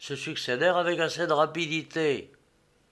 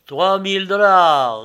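loud, slow, drawn-out official call; auctioneer repeating; 5 semitones higher, 12 dB louder than usual. A narrator's reading. Agent repeats the newcomer's bid, loud auctioneer call. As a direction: sing the bid back to the room. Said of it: "répéta l'agent".

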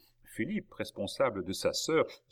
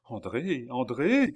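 building call, louder on the repeat; crying out; 4 semitones higher, 6 dB louder, slower than usual. A narrator's reading. Young man nearing the house, crying out for his sister. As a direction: call the name out, rising to a shout. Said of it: "cria le jeune homme".

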